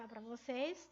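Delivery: ordinary talk, nothing marked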